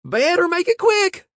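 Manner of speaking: loud, high urgent holler. A narrator's reading. Lookout bursting into the bank, loud hollered warning. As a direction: yell a warning bursting through the door.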